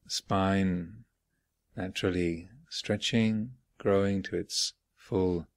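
unhurried phrases with long silences between; guided meditation. Real, not acted. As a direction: guide them gently inward, leaving space after each phrase. Leads guided morning meditation.

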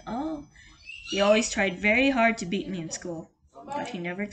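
usual speaking voice

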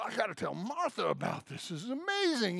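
put-on a light Southern gentleman accent, excited. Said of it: "he's a Southern gentleman in my mind".

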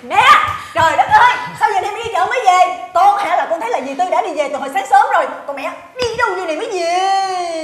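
loud, whiny interrogation; hollering. Acted. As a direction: holler accusingly at mother-in-law, drawing out the end. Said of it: "Bà la, bà làm tôi hết hồn".

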